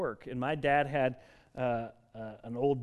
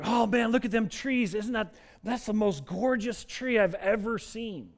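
imitating dad's delight; 8 semitones higher than usual. Father marvelling at trees.

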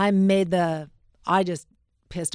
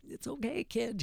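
sympathetic reassurance; 6 semitones higher, 11 dB quieter than usual. Retold or imitated. Cameraman's silent sympathetic look.

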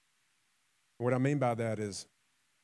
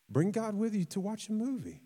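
playful plea; 9 semitones higher than usual. Pretend. Playful invitation to God.

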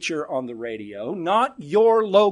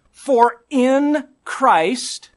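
very slow, higher and louder; slow emphatic reading; 4 semitones higher, 4 dB louder, much slower than usual. Real, not acted. Preacher reading Colossians to the church, drawn out. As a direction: draw each word out.

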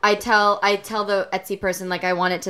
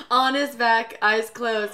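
ordinary talk, nothing marked

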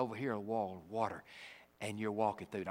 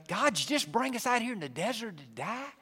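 complaining Israelites; 10 semitones higher, 6 dB louder than usual. Retold or imitated. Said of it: "What did they say?".

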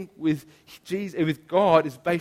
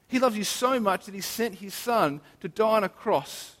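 usual speaking voice

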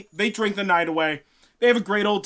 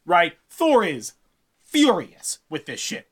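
usual speaking voice

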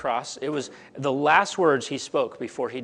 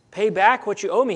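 louder and higher, a harsh demand for the debt; 6 semitones higher, 7 dB louder than usual. Acted. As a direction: bark it at the man who owes you. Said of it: "he demanded".

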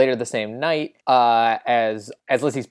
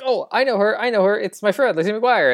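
high-pitched urgent pleading, imitated; mock plea to be let through; urgent protest; 8 semitones higher than usual. Retold pleading with security.

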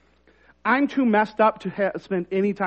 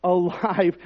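usual speaking voice

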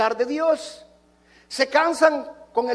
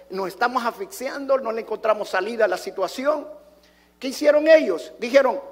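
usual speaking voice

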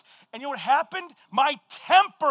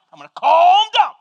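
far from calm, louder and tense; loud mock calm-down; 3 semitones higher, 8 dB louder, faster than usual. Pastor shouting at himself to calm down after the dog chase. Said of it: "I'm, like, I'm gonna calm down".

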